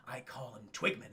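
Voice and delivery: low, soft imitation; subdued deadpan. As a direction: murmur it low, acting detached. Deluded actor's line, retold.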